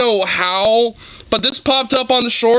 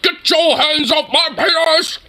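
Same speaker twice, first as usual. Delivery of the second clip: imitating a suddenly alerted man, higher; lightly hammed-up indignant outburst; mock alarm; 7 semitones higher than usual. Imitating the man in the arrest clip, mock alarm. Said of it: "like, alerted".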